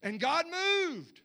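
slow, loud, drawn-out preaching; preacher proclaiming that God moved, booming and slow; preaching at full force. Real, not acted. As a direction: lean into each word, slow and big.

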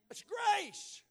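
high, loud burst; preacher's shout. Acted. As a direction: shout it to the rafters. Climactic shout.

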